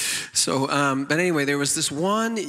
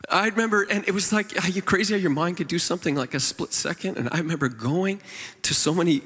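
ordinary talk

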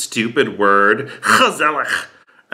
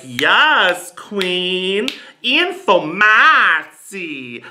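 high, drawn-out villager approval; hammed-up adoring villagers, pitched up; cheering villagers; 5 semitones higher, much slower than usual. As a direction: gush admiringly, stretching the vowels. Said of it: "all the villagers were like".

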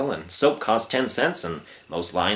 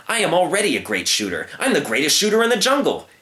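put-on boastful young lion; 6 semitones higher than usual.

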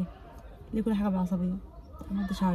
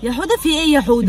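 raised voice; 5 semitones higher, 8 dB louder than usual. Acted. Arguing with husband over hair.

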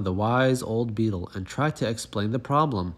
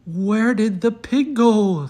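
much higher, loud, slow alarmed cry; 11 semitones higher, 9 dB louder, slower than usual. Acted. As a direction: animals alarmed the pig is gone, calling out loud. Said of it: "the animals cried".

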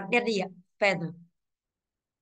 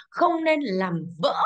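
slow emphasis; much slower than usual. Shown as a demonstration. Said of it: "teacher stressing the answer".

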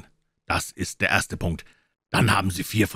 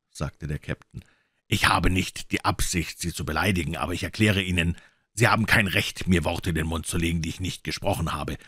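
ordinary delivery, nothing marked

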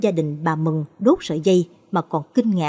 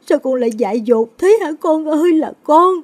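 high, loud wailing lament; keen over the lost son; mother cries out at her hanged son; anguished wail; 8 semitones higher, 6 dB louder than usual. Acted. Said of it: "gào lên đau đớn".

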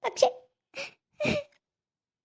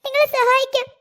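frightened pleading; 3 semitones higher than usual. Acted. Girl begs the captor.

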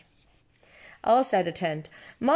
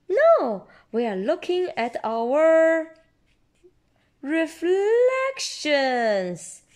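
high, louder eager reply; excited Peppa; 8 semitones higher, 3 dB louder, slower than usual. A narrator's reading. Parent voicing Peppa's eager high reply. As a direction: burst out eagerly then slow down.

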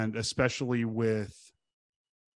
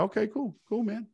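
higher, relieved okay cool; 10 semitones higher than usual. Retold or imitated. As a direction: let out the relief, pitched up. Relieved friend still cool.

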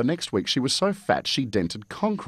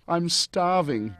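higher, slow pleading whine; begging; 9 semitones higher, 3 dB louder than usual. Acted. Stans begging the Virgin for food, higher whiny plea. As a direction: beg like a hungry brat. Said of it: "begged Stans".

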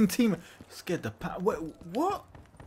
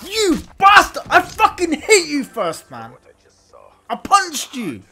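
screamed high and loud insults; furious shout; 10 semitones higher, 11 dB louder, slower than usual. Real, not acted. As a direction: yell at the player who killed you. Raging at enemy in game.

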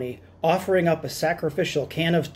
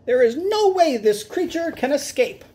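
high squeaky certainty; confident showman; 10 semitones higher than usual. Acted. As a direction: promise the crowd it's safe, squeaking. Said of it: "Doctor Varmint told the crowd".

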